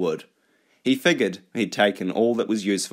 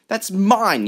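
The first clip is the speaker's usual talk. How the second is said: high, loud protest; sharp raised cry; yell; 13 semitones higher, 5 dB louder than usual. Acted. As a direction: yell it as you lunge to take it back. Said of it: "yelled Shizlev".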